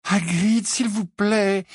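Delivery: high, drawn-out plea; touch of despair. A narrator's reading. Pupil pleads with Hagrid to be sensible, touch of despair. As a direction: beg him, slightly desperate. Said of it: "avec une nuance de désespoir dans la voix".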